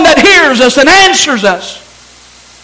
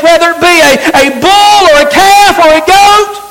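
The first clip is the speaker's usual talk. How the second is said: high, loud pulpit preaching; fiery Sunday sermon; preaching at full force; 7 semitones higher, 4 dB louder than usual. Acted. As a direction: drive it home to the congregation.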